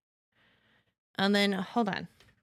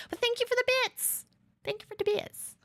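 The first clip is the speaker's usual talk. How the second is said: excited; 13 semitones higher, faster than usual. Real, not acted. Thanking viewer for donated bits.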